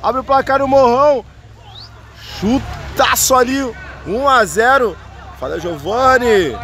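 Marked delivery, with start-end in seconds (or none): 0.0-4.9 high, stretched "olha o gol" call; drawn-out goal call. Real, not acted. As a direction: hold the goal cry long and high. (grito de gol arrastado)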